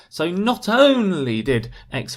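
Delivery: NOT ONLY loud, slow and swooping high; emphatic stress. Shown as a demonstration. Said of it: "you're using stress".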